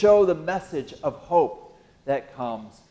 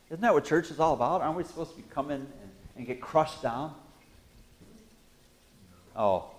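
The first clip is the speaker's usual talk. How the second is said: usual speaking voice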